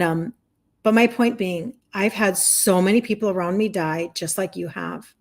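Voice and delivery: usual speaking voice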